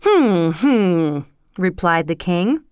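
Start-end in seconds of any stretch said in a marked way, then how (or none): 0.0-1.2 two long falling hums; pondering royal "hmm, hmm". A narrator's reading. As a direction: hum it over like a pondering king. King ponders his reply.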